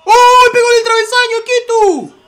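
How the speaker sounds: very high, loud shout of shock; astonished scream. Real, not acted. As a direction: scream as the shot rattles the crossbar. Shot hits the crossbar. (grito agudísimo de asombro)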